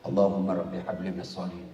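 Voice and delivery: hushed prayer breathed into the ear; whispered prayer. Retold or imitated. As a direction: whisper it close to the boy's ear. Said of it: "Saya bisik telanganya".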